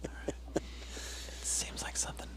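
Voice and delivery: hushed whisper with a laugh. Real, not acted. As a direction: whisper under your breath. Continued whispered exchange.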